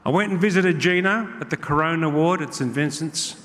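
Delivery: usual speaking voice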